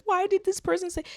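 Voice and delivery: a mocking wail of hurt feelings; mock boo-hoo crying. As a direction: whine it like a mock crybaby. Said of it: "like, boo-hoo crying".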